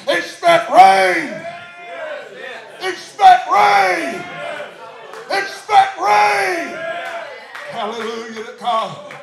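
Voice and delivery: loud, driving revival preacher shout; full-force preaching. Real, not acted. Preacher telling the church God says expect rain, full-force preaching.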